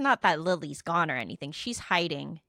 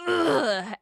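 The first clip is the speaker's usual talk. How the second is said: loud, higher "ugh" of contempt; disgusted groan; 6 semitones higher, 4 dB louder than usual. Retold or imitated. Friend watching him wave timidly, disgusted groan. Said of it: "I'm looking at him like".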